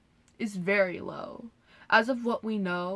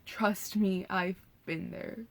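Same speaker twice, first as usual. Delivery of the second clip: ordinary talk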